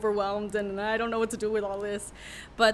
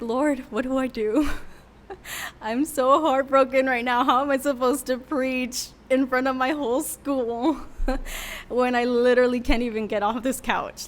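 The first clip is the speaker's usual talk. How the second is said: distressed prayer; 4 semitones higher, 8 dB louder than usual. Retold or imitated. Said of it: "So I was like".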